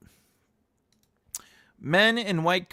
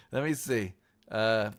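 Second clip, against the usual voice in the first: ordinary talk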